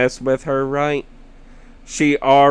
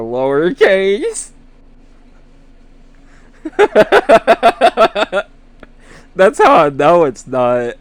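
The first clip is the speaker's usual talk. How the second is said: laughing; 7 semitones higher, 8 dB louder than usual. Real, not acted. Laughing at friend's spelling.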